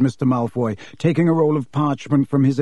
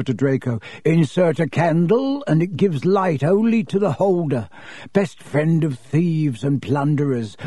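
oily shopkeeper. Acted. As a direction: savour it like a sly dealer. Borgin voiced by narrator.

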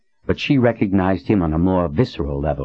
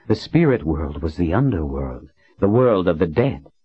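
ordinary delivery, nothing marked

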